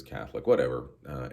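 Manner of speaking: usual speaking voice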